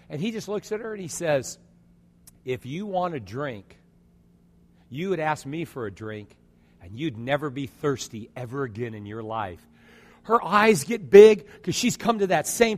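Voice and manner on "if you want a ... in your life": low, soft, unhurried promise; gentle offer. Acted. Jesus offering the woman at the well water, gentle. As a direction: lower and soften, offer it tenderly.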